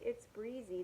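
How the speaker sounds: ordinary talk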